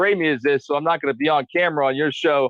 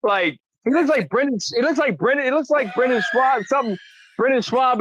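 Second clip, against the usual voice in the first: heated mocking rant; 8 semitones higher than usual. Real, not acted. Heated mocking rant about critic.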